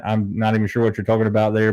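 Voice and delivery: ordinary delivery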